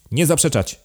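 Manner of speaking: high, sharp command; cried out. A narrator's reading. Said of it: "krzyknął hrabia".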